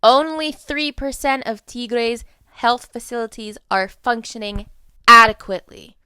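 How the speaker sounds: loud and pointed, high; emphatic loud. Real, not acted. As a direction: hammer the statistic home. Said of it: "Listen closely to this one".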